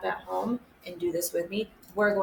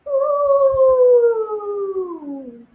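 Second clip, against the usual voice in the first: long, high, loud ooh sliding down; vocal warm-up siren; 13 semitones higher, 10 dB louder than usual. Shown as a demonstration. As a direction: hoot high, then glide down. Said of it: "getting your singing voice all warmed up".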